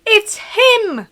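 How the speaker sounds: high, loud excited cry; hammed-up excited yell; excited exclamation. A narrator's reading. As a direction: shriek it like an overexcited fan. Class sees the awaited guest.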